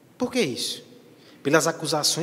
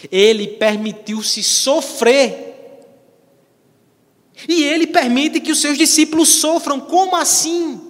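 loud, high outcry from the pulpit; impassioned preaching; 11 semitones higher, 9 dB louder than usual. Acted. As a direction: cry it out at full force.

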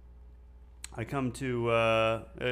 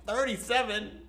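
high-pitched, indignant comeback, retold; acted outrage, pitched way up; livid retort; 9 semitones higher than usual. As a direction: throw it back in offended disbelief. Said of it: "I was livid".

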